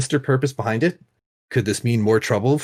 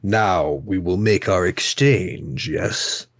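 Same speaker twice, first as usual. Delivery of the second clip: very slow, lordly sultan voice; grand languid royal commands; 5 semitones lower, much slower than usual. Acted. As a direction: press the deal like a smug king. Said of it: "I'm having fun doing the Sultan's voice".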